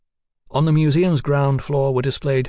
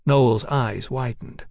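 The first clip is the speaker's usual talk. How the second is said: plain reading voice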